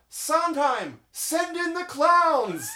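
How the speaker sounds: high, drawn-out stagehand's holler; yelling out. Acted. Stagehand calling for the clowns, yelled out. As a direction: bellow it across a theater, stretching the last word. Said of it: "He has a stagehand yell out".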